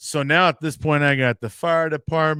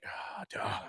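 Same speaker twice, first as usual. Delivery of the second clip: sheepish mutter; 4 semitones lower, 17 dB quieter than usual. Retold or imitated. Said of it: "I'm like".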